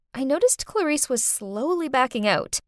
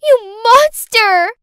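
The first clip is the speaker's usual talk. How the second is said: much higher, louder and slow, a screamed insult; furious child's shout; 11 semitones higher, 11 dB louder, much slower than usual. Acted. Girl screaming at her babysitter before running upstairs, higher, louder and slow. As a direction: hurl it at her like a tantrum.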